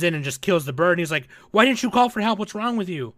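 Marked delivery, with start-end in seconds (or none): 1.5-3.1 higher-pitched concerned scolding, retold; all concerned. Retelling him scolding her for not calling for help, concerned. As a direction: act out a worried telling-off, pitched up. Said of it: "getting all concerned and shit".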